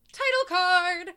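high, loud, drawn-out proclamation; mock announcer call. Host recapping the film's opening, mock announcer call. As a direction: sing out the announcement with a flourish.

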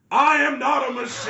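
loud, very high mock protest; retold telemarketer shout. Telemarketer protesting to the people he called, exaggerated high shout. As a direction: yell it as an exaggerated machine-like telemarketer.

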